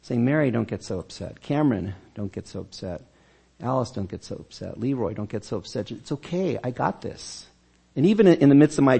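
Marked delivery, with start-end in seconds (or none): 0.2-7.5 softer, gentle and reassuring; compassionate voice. Shown as a demonstration. Pastor voices Jesus calming his congregation, softly compassionate. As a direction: soothe each anxious listener by name. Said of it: "with an ever so compassionate voice".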